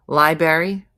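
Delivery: loud, questioning "lie-berry" for Library; mispronounced library. Shown as a demonstration. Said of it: "the forty most commonly mispronounced".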